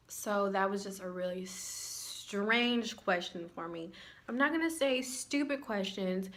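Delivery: usual speaking voice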